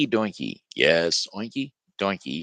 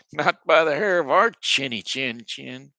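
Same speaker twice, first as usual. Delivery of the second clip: higher, louder pig voices; defiant little pigs; 6 semitones higher, 5 dB louder than usual. Acted. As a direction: squeal back safe behind the door. Pigs refuse wolf again.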